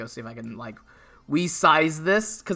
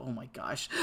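normal talking voice

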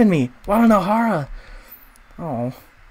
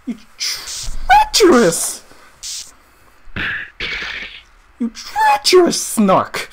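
outraged accusation; 10 semitones higher, slower than usual. Real, not acted. Denouncing the traitor.

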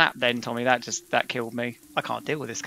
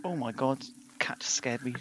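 whisper; 3 semitones lower, 12 dB quieter than usual. Real, not acted. Startled by cat in dark.

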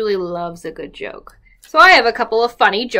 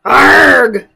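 loud pirate growl; pirate arg; 6 semitones higher, 13 dB louder than usual. Acted. Little pirates call back arg to their mommy. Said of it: "call out her little pirates".

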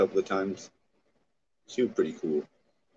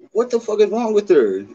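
outraged; 13 semitones higher, 7 dB louder than usual. Real, not acted. Outraged at a woman's comment.

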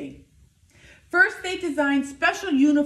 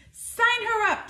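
coach's call; 10 semitones higher than usual. A narrator's reading. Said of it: "said the coach of the Keshewa Comets".

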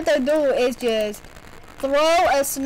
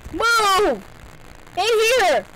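shouting; 7 semitones higher, much slower than usual. Real, not acted. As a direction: yell at the screen. Shouting at character.

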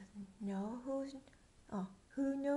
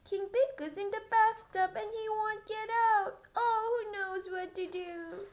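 high loud worried cry; panicked page; 8 semitones higher, 7 dB louder than usual. Acted. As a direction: wail it like a frantic page. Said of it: "cried the page".

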